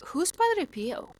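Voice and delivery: put-on high, slow old-lady question; questioning grandma. Grandma asks her question in the dream, high slow puzzlement.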